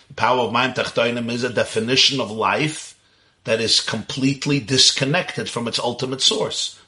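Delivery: usual speaking voice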